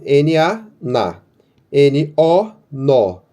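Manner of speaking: "N-A" spelled slowly, then "na" said as one syllable; spelling out syllables. Shown as a demonstration. Teacher spells and sounds out "na" and "nó" for students. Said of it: "é N".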